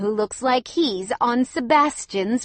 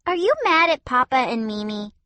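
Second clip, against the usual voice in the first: put-on high child's voice, louder; little girl asking; 5 semitones higher, 5 dB louder than usual. A narrator's reading. Cassia asking her grandmother why she is mean. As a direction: ask like a puzzled small child.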